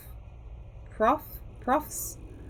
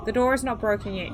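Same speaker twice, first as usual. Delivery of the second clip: ordinary talk, nothing marked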